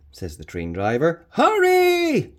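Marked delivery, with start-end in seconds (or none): 1.3-2.3 very high loud shout; high loud urgent yell. Acted. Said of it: "Doctor Glenda shouts".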